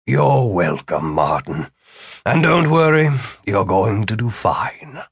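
slow, drawn-out reassurance; comforting. Acted. Mentor calming his nervous student, slow and comforting. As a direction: reassure slowly, in a put-on character voice. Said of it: "Philip put a comforting hand".

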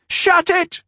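high, loud snap; angry. A narrator's reading. Christine silencing the servant, angry snap. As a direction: cut him off hard. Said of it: "Christine said angrily".

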